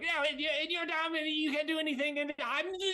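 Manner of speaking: high, fast gloating villain; Zippotra. Acted. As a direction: crow in a squeaky rush, tipping into laughter. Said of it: "Zippotra's continues to be like".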